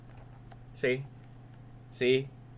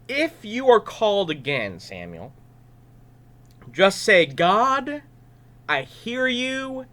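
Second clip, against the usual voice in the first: higher, louder earnest instruction; kindly instructing old priest; 7 semitones higher, 5 dB louder than usual. Acted. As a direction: coach the boy gently. Eli instructing Samuel.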